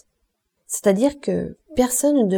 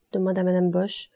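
ordinary delivery

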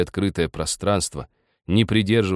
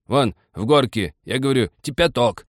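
put-on irritated old man, pitched up; cross insistence; 6 semitones higher, 4 dB louder than usual. A narrator's reading. Old man directs Nikita to the cupboard, crossly. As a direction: spell it out impatiently. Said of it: "сердито повторил старик".

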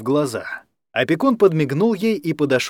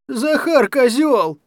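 slow, much higher wailing yell; sobbing shout; 11 semitones higher, much slower than usual. A narrator's reading. Kostya complaining to his sister, slow high wail. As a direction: drag it out like a hurt child. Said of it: "крикнул Костя".

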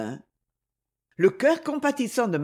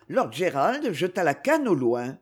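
plain reading voice

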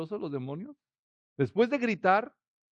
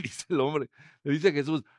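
normal talking voice

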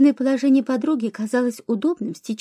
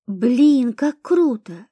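ordinary delivery

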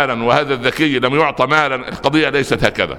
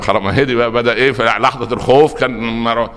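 usual speaking voice

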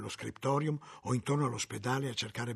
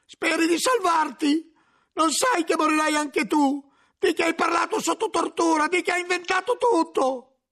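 angry shouting; 9 dB louder than usual. Acted. Cellarer berates the monk who betrayed him, shouting. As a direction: hammer him with furious questions. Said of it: "gli gridò ancora Remigio".

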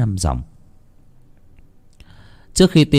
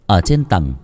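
ordinary delivery

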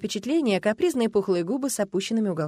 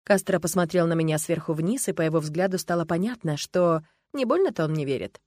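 usual speaking voice

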